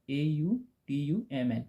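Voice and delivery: slow, letter by letter spelling. Shown as a demonstration. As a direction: spell each letter out slowly for the class. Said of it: "spelling क्या होता है?".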